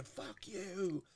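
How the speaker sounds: high, soft pretend holler; half-voiced high shout from afar; mock yelling. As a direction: reenact a drawn-out yell at reduced volume. Reenacts cursing at drive-thru window.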